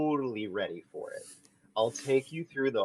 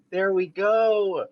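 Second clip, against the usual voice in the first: slow, higher, proud praise; encouraging; 5 semitones higher, 5 dB louder, slower than usual. Acted. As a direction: reward her like a pet. Praising like a trainer.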